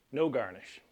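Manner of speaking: usual speaking voice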